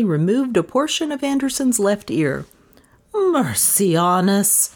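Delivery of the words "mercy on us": slow, drawn-out moan. A narrator's reading. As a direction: sigh the words out in horror. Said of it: "she moaned".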